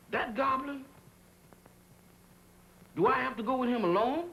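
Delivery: high, frightened plea; scared pleading. Acted. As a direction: beg not to go alone. Frightened servant begs not to go.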